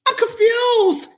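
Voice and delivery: high, slow crying shout; mock wail. Exasperated commentary.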